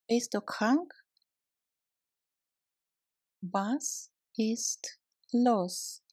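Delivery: ordinary talk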